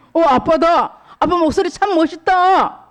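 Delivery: put-on high, thrilled little girl; girl hearing her father's voice, high and thrilled; delighted child. Sora hears her father.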